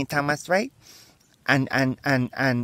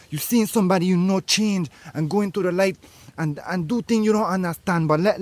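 higher, lofty sermon voice; preaching guru; 5 semitones higher than usual. Acted. Guru preaches wisdom to his friends, grandly. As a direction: deliver wisdom like a prophet.

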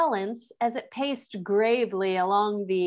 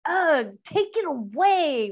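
higher, louder whiny wail; wailing in disgust; 7 semitones higher, 4 dB louder than usual. A narrator's reading. Eustace disgusted by the mouse, wailing. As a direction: wail it like a spoiled boy. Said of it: "wailed Eustace".

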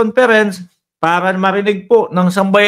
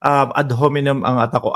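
ordinary talk, nothing marked

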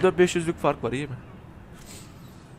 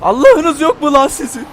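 loud furious shout; angry yell; 9 semitones higher, 11 dB louder than usual. Real, not acted. Gamer shouting at rival cars in anger. Cursing opponents in race.

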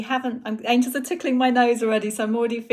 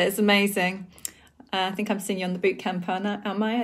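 ordinary talk, nothing marked

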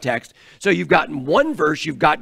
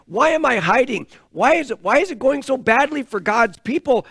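anguished Gideon; 7 semitones higher than usual. Acted. Gideon voiced complaining to God.

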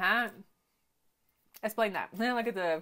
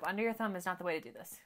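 ordinary delivery

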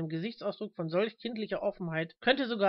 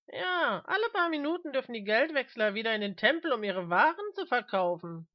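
high, swooping mocking voice; mocking companion; 4 semitones higher than usual. A narrator's reading. Mister Smith needling the old man, high mocking voice. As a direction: start high and gleeful, then come down.

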